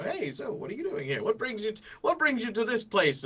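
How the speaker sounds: high-pitched mock small talk; mock casual chat. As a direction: pitch it way up, parodying a breezy hello. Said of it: "just chatting very casually".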